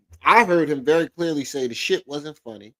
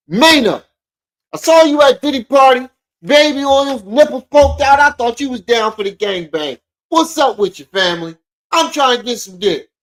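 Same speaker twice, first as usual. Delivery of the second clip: high, loud hammed-up angry rapper; mocking imitation; 8 semitones higher, 6 dB louder than usual. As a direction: whine it up an octave with fire. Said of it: "That's what he sounds like to me".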